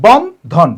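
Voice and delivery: "বন্ধন" slowly as বন, pause, ধন; two separate beats on "Bandhan"; split into syllables. Shown as a demonstration. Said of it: "দুইটা অক্ষর". ("বন্ধন" ধীরে ভেঙে, বন... ধন)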